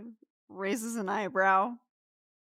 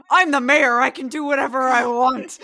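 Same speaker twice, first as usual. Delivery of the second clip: loud self-important shout, higher pitched; angry, booming mayor; 5 semitones higher, 11 dB louder than usual. Acted. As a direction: bellow it like an outraged mayor pulling rank. Angry mayor overrides Ethan's objection.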